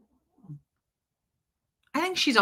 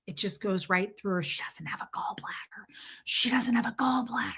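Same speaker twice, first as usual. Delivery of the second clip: unvoiced hushed gossip; imitating mom whispering about a gallbladder; mock whisper; 7 dB quieter than usual. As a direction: whisper it like a secret. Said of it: "You don't have to whisper that".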